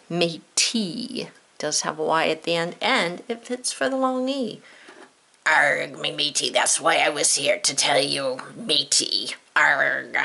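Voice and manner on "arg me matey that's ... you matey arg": lower, gruff cartoon pirate insisting. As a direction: speak as a playful pirate, pitched lower. Pirate explains why he came.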